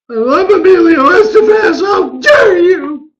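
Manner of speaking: hammed-up high outraged yell; mock outraged shout. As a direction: shriek in fake offence at the mixup. Mock outrage over film mixup.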